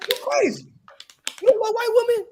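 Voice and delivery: high, squeaky mocking voice; mock outraged accuser.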